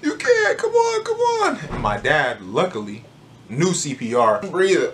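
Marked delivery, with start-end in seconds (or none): 0.0-1.6 put-on much higher, pleading crying kid voice; crying little brother. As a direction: beg like a panicked little boy. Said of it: "My little brother was crying".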